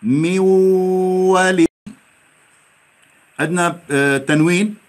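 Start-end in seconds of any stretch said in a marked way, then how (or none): 0.0-1.7 ميولي slow with merged nasal; slow recitation. Shown as a demonstration. Teacher models correct reading. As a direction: recite ميولي slowly, nun merged into waw. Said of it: "نقرأ صح".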